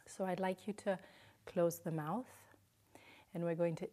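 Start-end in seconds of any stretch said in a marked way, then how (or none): none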